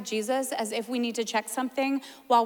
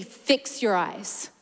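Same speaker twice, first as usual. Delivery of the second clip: slow, low, calm voice of God; gentle command; 4 semitones lower, much slower than usual. Retold or imitated. The Lord speaking calmly to her on the dock, slow. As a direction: say it slow and steady. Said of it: "the Lord say to me".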